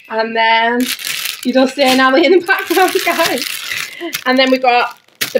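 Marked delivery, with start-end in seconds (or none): 1.4-3.4 quick, higher, laughing aside. Real, not acted. Speaker to viewers about Nally in the background, laughing. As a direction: say it fast through a laugh.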